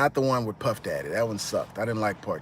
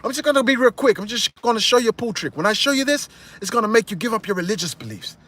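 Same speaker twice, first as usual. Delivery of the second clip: much higher, louder boastful pitch; showman hustle; 10 semitones higher, 4 dB louder than usual. Retold or imitated. Carlito setting up his escape trick, high boastful sell.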